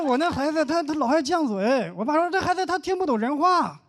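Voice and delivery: high-pitched, animated complaining; mock griping parents. Two parents compete running down their kids. (模仿家长抬高嗓门数落孩子)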